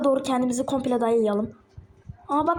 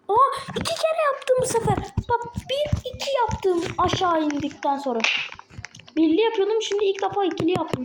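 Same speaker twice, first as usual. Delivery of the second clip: high, thrilled squeal of success; excited; 6 semitones higher than usual. Real, not acted. Gamer cheering his double jump to viewers, excited. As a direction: burst out happy, voice jumping up.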